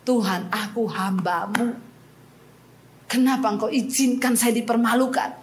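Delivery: hushed tearful cry to God; tearful prayer. Retold or imitated. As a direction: plead quietly, near tears. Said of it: "Saya menangis di kaki Tuhan".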